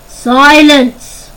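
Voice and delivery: one huge loud command; commanding bellow. Acted. Chief Lopkin silencing the panicking warren, commanding bellow. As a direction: bellow it to freeze the crowd. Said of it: "bellow in his great commanding voice".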